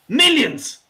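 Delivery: Millions shot up high and loud; stress on Millions. Real, not acted. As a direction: punch the word Millions. Ranting about the fans.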